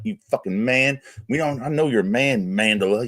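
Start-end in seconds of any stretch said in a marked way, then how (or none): none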